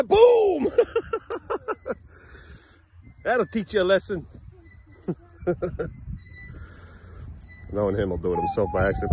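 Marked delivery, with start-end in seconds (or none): 0.0-0.7 loud, high-pitched impact cry; playful boom. Real, not acted. Parent playing at swing push. 0.7-1.9 high giggling laugh; laughter. Real, not acted. Laughs after the boom. 5.4-6.2 short giggly chuckle. Real, not acted. Laughs at the play.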